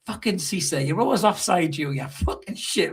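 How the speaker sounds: ordinary talk, nothing marked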